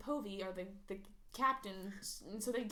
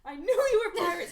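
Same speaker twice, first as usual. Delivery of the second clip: mock shout; 7 semitones higher, 12 dB louder than usual. Adam's retold triumphant accusation.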